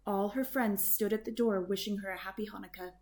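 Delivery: usual speaking voice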